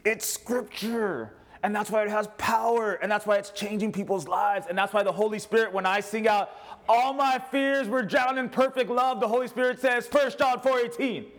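high, ringing preacher's call; preaching. Acted. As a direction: lift it up like a revival preacher.

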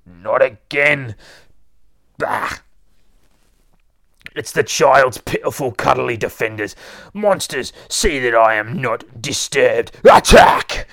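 loud, theatrical monster lord; scornful, drawn-out villain proclamation; villainous Boogeyman. Acted. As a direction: sneer at the defenders, building to a shouted command.

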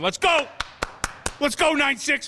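imitating a shouting quarterback; mock shout of Let's go; mock sideline yell. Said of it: "Doug Brumfield wasn't on the sideline".

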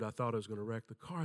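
usual speaking voice

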